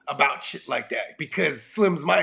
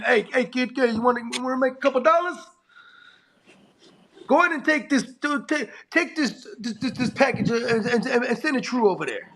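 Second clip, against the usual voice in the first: higher-pitched neighbourhood hustler imitation; lightly voiced mobster; 6 semitones higher than usual. Local mobster recruits a kid for an errand, lightly acted hustle.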